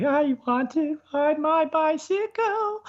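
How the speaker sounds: very high, slow, drawn-out creepy pervert voice; Herbert the Pervert. Acted. Showing off the cartoon voice from the bicycle scene, high creepy croon. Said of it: "doing Herbert the Pervert's voice from Family Guy".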